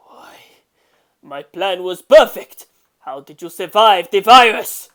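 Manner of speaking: furious villain facing survivors; shocked outrage. Acted. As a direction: rage that the plan failed. Villain's plan fails.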